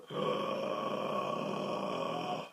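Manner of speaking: unvoiced inward gasp; silent gasp. Shown as a demonstration. Teacher showing the Mueller maneuver. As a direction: suck air in silently. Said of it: "This is called the Mueller maneuver".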